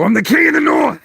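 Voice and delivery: very high, full-force bellow; battle-cry shout. Acted. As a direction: roar it out as an acted king. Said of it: "And I go".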